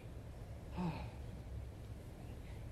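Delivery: long gentle sigh out; soft sigh. Shown as a demonstration. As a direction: let out a relaxed sigh. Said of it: "Maybe a little sigh".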